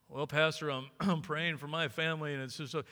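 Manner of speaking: low, halting congregant voice; excuse-making church member. Acted. Church member excusing himself to the pastor, low and halting. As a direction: hedge sheepishly in a low voice.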